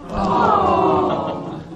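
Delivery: high delighted Oh, soaring up; happy oh. Pretend. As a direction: sing out a joyful Oh. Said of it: "You make me so very happy".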